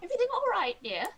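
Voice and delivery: put-on sweet old lady asking gently; old woman's voice. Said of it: "this old woman's voice".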